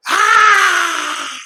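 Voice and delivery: high startled scream; mock scream. Wife seeing her husband collapse, high hammed-up scream. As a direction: shriek at the sight. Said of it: "and went".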